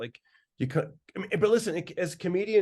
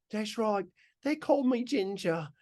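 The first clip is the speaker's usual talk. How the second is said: a bullied English bloke, pitched up; higher, chirpy British voice; British accent; 7 semitones higher than usual. Retold or imitated. Comic doing his bullied English guy in a British accent. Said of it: "that's a good British accent".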